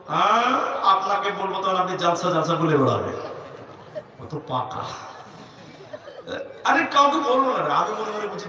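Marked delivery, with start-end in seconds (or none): none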